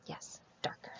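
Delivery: barely breathed whisper. Real, not acted. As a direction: confirm it in a secret whisper. Answering children, hushed.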